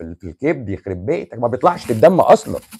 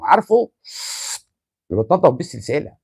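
usual speaking voice

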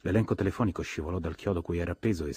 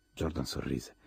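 usual speaking voice

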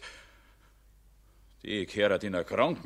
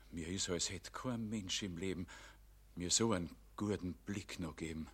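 moved hush; 4 semitones lower, 12 dB quieter than usual. Acted. Jedermann moved, softly.

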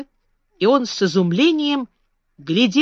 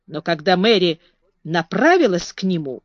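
ordinary delivery, nothing marked

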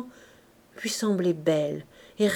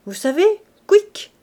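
much higher, loud "quick" as if swallowing the tongue; throat gulp; 11 semitones higher, 8 dB louder than usual. Acted. Gravedigger jokes about death to Gervaise, mock gulping sound. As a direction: mime a gulping throat click. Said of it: "il fit un effort de la gorge".

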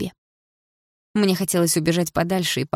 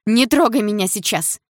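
higher, agitated rebuff; upset protest; 5 semitones higher, 4 dB louder than usual. A narrator's reading. Heroine telling him not to touch her, upset protest. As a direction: push him away with a raised voice.

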